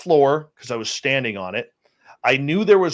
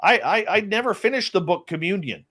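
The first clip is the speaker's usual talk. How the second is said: excited protest; 8 semitones higher than usual. Retold or imitated. Protesting to his buddy about aliens, high and worked up. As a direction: push back hard, voice up high.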